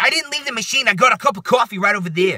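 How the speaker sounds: high, loud New York protest; mock an indignant New Yorker; New Yorker accent. Woman protesting to the supervisor, mock New York accent. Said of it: "like, New Yorker accent".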